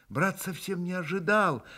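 ordinary delivery

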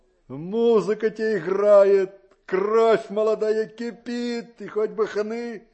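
loud and slow, drawn out with bitterness; loud, slow outburst. Acted. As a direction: belt it out slowly, bitter at carefree youth. Uncle's outburst about youth.

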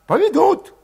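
put-on high, tense young Cossack voice; high-voiced young troublemaker; Valet's voice. A narrator's reading. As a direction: pitch him up high and edgy.

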